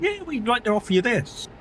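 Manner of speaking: high-pitched mock sales pitch; company offer. Company messaging a streamer with an offer, mock sales voice. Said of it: "they send you a message going".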